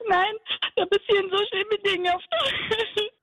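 unter Tränen, Stimme deutlich höher; weinend. Real, not acted. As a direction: klagt schluchzend und aufgewühlt. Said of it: "Bitte nicht mehr weinen". (echt weinend und aufgelöst)

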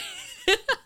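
high hammy laugh imitation; imitated laugh. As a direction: mimic a hearty laugh. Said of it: "Holmes laughs like he means it".

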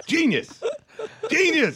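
laughing. Real, not acted.

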